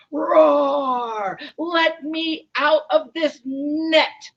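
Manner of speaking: loud, high roar, then slow demand; enraged lion. Acted. Lion struggling in the net, furious roaring. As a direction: roar and bellow the words out. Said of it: "was not getting any calmer".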